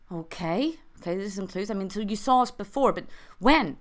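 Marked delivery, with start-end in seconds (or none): none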